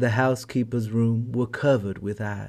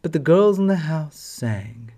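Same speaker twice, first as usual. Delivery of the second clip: usual speaking voice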